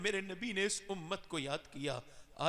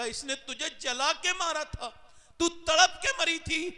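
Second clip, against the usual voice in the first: booming reminder; 8 semitones higher, 8 dB louder than usual. Acted. God reminds the mother.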